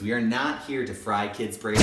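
ordinary talk

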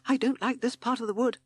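high, nervous toad voice with a stumbling start; timid toad shudder. A narrator's reading. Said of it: "said timid Toad with a shudder".

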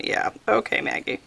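soft, whispery aside; muttered self-mockery. Real, not acted. Crafter answering her own doubts, whispery sarcastic aside. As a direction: dismiss yourself under your breath.

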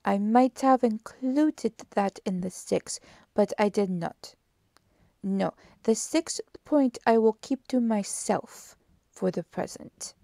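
emphatic, deliberate detective keeping his counsel; Poirot. Acted. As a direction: stress the key words, then ease off. Poirot keeps a point back.

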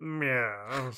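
soft, lightly voiced meow; a quiet cat impression. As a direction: mew like a cat. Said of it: "excellent Japanese cat impersonator".

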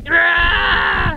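loud drawn-out roaring yell; battle cry. Pretend. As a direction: roar as you charge in. Mock battle cry at enemy.

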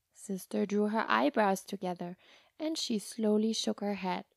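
plain reading voice

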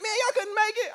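high-pitched mocking impression of someone; mock incredulous quote. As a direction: exclaim it far up high, imitating him. Retold teasing phone reply.

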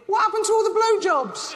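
high mock whine; squeaky thought voice. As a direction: squeak it out like an injured man's indignant thought. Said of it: "He must be thinking".

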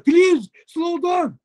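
high, very slow imitated begging; mock pleading. Old partner begging the driver, slow high mock plea. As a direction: drag out the please like a begging man. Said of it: "bana yalvarıyor".

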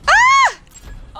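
short, very high scream; startled scream. Real, not acted. Player caught off guard in a fight, high startled yelp. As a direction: yelp high in sudden alarm.

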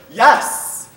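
a loud, high burst of yes; emphatic yes. Retold or imitated. A crisis line caller answering the question, blurting yes loudly. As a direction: blurt it out like a caller who can't hold back.

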